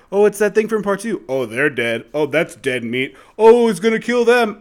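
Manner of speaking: mock moviegoer commentary, jumping high and loud at the end; oblivious out-loud running commentary; full-volume movie narrating. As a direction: imitate someone narrating a film out loud. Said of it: "talking in the tone of voice".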